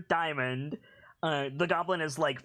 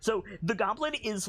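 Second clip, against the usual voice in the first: usual speaking voice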